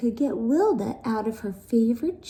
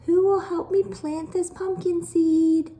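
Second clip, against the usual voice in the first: high, louder call to the sisters; 9 semitones higher, 4 dB louder than usual. Acted. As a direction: call across the house for help. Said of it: "she called to her sisters".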